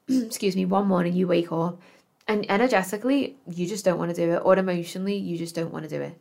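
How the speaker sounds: usual speaking voice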